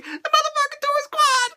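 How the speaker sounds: high squeaky fan voice; mock the internet crowd. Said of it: "everybody would be like".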